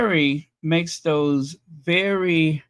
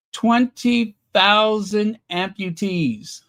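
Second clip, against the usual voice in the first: "Twenty thousand amputees" slow and higher, each word weighted; emphatic repetition; 6 semitones higher, slower than usual. Real, not acted. Repeats the shocking figure for emphasis.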